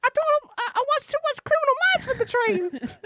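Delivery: very high squeaky Muppet voice. Acted. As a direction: do Billy Hamilton as a high-pitched Muppet. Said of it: "I gotta get my Muppet voice going".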